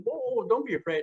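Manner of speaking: high, hushing reassurance; mock soothing. Quoting somebody who tried to calm his fear, high and hushing. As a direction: imitate someone calming him down. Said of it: "Somebody said".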